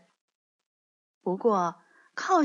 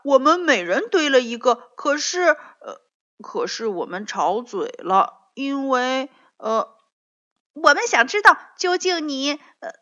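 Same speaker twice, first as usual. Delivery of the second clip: loud, high, fast eager chatter; interrupting each other eagerly; talking over each other; 5 semitones higher, 8 dB louder than usual. A narrator's reading. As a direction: rush to tell first. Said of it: "争先恐后地说".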